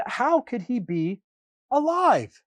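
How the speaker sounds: usual speaking voice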